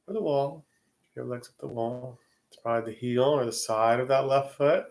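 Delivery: guided meditation. Real, not acted. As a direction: ease them gently into the body scan, unhurried. Leads a Yoga Nidra session.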